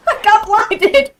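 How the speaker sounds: laughing high and slow through the words. Real, not acted. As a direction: crack up at getting blinded. Laughs at screen glare.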